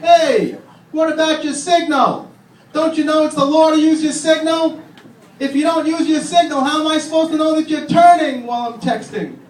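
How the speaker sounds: high, loud yelling out the car window; mock road-rage yell. Driver yelling at another driver, high and loud. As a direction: let the bad driver have it. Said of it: "I let him have it".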